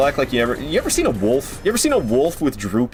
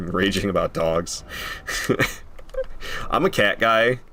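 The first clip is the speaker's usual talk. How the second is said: normal talking voice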